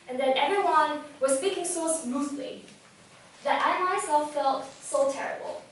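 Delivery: normal talking voice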